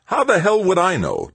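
high, loud exasperated snap; snapping. A narrator's reading. Tavalera snapping back at Mayfair's accusation. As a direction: bite back in anger. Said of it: "Tavalera snapped".

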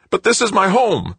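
cling to it, voice up high; high wailing plea. Acted. Son to mother, high wailing protest. Greg pleads again.

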